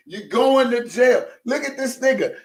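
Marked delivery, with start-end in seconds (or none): 0.1-1.3 high, slow mock-stern verdict; Levi's voice again, drawn out. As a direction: announce it like Levi. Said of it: "In my Levi voice".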